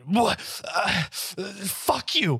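high, slow, sputtered curse; sputter, then curse them out in character; angry outburst. Acted. Talia cursing after being held back, high and angry.

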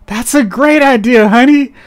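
high, sweet placating voice; humoring a child. Retold or imitated. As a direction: humor him like a kid. Wife humoring husband's bad plan.

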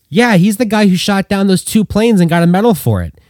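high, loud excited yell. Acted. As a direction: call it out across the hut. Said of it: "Andy yelled out".

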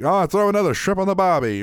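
high-pitched, put-on Aussie accent; teasing mock Aussie twang; mock Australian accent. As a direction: do a hammed-up Australian caricature. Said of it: "he always mentions that I'm Australian".

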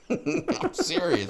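ordinary delivery

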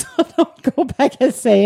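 words spilling out through a laugh; giggling warning. Real, not acted. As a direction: laugh as you start the line. Laughing while warning listeners.

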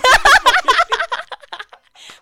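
big high burst of laughter; loud laughter. Real, not acted. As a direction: crack up loudly. Laughing after shout.

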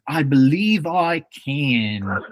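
a hammed-up Southern accent audition; mock Southern accent. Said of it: "Can you do a Southern accent?".

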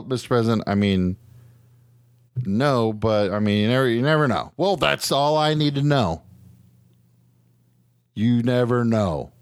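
usual speaking voice

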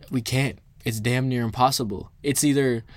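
normal talking voice

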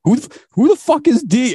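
high, indignant mock outburst; imitating pissed-off friend. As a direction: act out an incensed friend, pitch shooting way up. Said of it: "And he got pissed".

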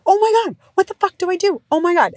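freaking out over a first tantrum; panicked mom. Retold or imitated. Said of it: "I remember being like".

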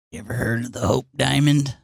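low bit voice. Retold or imitated. Imitating the running bit's opener.

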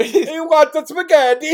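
high, slow, loud cartoon Italian accent; mock Italian accent. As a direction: stretch it out like a cartoon Italian chef. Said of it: "go full fucking Mario".

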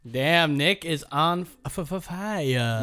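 mock stutter. Playful stuttered hype.

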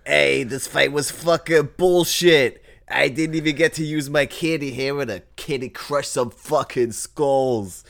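a candy creature bragging about violence; cookie monster voice. Acted. Gingerbread half complains about the fight, loud high crude voice. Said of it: "the mouth starts to move as I talk, going".

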